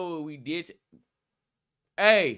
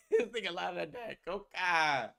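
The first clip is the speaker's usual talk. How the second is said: laughing tease; 3 semitones lower, 6 dB quieter than usual. Pretend.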